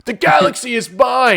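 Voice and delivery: loud, high gloating villain; evil emperor voice. Retold or imitated. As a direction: crow it like a triumphant villain. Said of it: "He's like".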